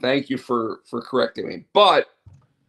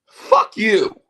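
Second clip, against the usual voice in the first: mock dismissive jab; 8 semitones higher, 8 dB louder than usual. Mocking the companies' excuse.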